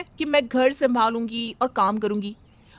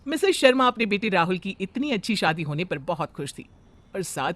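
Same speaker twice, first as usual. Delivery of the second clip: calm, lower storyteller voice; story narration; 4 semitones lower than usual. A narrator's reading. Narrator introducing the new bride, steady storytelling. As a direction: narrate the family tale evenly.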